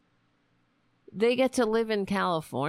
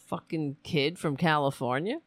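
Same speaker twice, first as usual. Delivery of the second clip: ordinary talk, nothing marked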